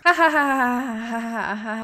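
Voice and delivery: staged villain laugh, spelled out ha ha; hammed-up hacker cackle; mock evil laugh. Pretend hacker mocks boys with a mock evil laugh.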